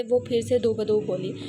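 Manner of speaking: ordinary delivery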